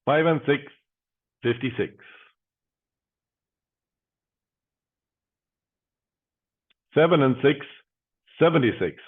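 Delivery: steady, rhythmic number calling; bingo caller. Acted. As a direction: call each number out, then pause.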